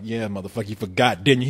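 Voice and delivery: low, soft growl of a dog; a menacing low dog; gruff dog voice. Retold or imitated. The dog needling the dad, low and soft. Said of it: "the dog's like".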